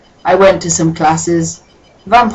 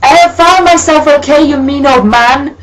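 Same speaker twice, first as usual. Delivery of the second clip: much higher, loud indignant shout; hammed-up yell; 9 semitones higher, 6 dB louder than usual. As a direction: yell it like a sulky teen. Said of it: "Hagrid yelled".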